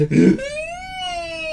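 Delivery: long, high exaggerated gasp; cartoonish rising inhale; deep breath. A narrator's reading. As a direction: suck in a huge noisy breath. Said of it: "he took a deep breath".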